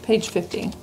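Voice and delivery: ordinary delivery, nothing marked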